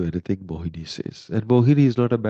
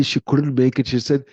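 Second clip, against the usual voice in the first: ordinary talk, nothing marked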